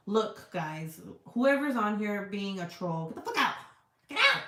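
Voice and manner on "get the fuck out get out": rising shout, much higher and louder; yelling at trolls. Real, not acted.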